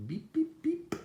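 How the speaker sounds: high, loud little horn toots; three squeaky storybook beeps; truck beeps. A narrator's reading. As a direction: imitate an eager small truck's horn. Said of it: "says Little Blue".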